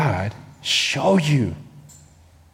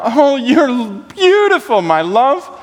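adoring lover; 7 semitones higher, slower than usual. Acted. Husband voiced adoring.

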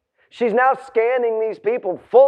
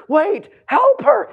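higher, drawn-out plea; urgent onlooker voice, pitched up; acted alarmed protest; 11 semitones higher, slower than usual. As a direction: call out in mock alarm. Acts onlookers objecting to Jesus.

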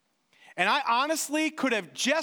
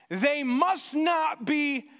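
slow, higher, shouted condemnation; forceful decree; 4 semitones higher, much slower than usual. Acted. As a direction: hammer out the verdict to the king. Haman demands destruction.

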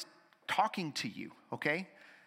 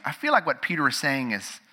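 ordinary talk

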